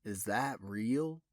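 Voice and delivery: awed detective, halting and hushed; stammered. A narrator's reading. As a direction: lose the words in disbelief. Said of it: "he stammered".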